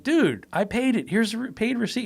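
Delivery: high-pitched retold pleading, stretched on "Dude"; exasperated protest. Customer shows driver the receipt, exasperated protest.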